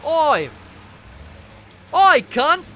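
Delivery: loud, high Australian holler; Australian accent. Shown as a demonstration. Host answering a dare with his best accent, shouted mock Australian. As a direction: bellow a hammed-up Aussie greeting. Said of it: "Do your best Australian accent".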